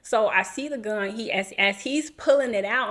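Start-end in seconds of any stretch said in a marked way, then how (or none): none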